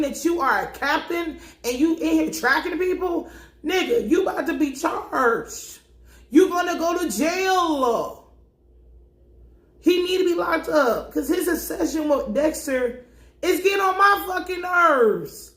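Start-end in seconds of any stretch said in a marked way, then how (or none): 3.6-15.5 exasperated yelling. Real, not acted. Ranting at Batista.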